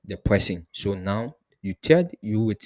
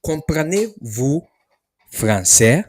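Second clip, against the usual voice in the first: slow, louder Comprenez-vous français; slow model question; 5 semitones higher, 7 dB louder, slower than usual. Shown as a demonstration. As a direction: spell it out slowly for learners. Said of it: "This is how you ask the question".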